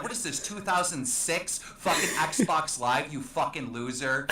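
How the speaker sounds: ordinary talk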